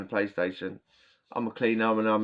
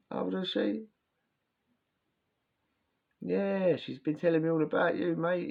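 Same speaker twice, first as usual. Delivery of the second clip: high, gushing fatherly greeting; sweet polite dad; 8 semitones higher than usual. Retold or imitated. Girlfriend's dad greeting the teller, high and sweet. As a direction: play the overly sweet old dad. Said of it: "he was all sweet and all polite".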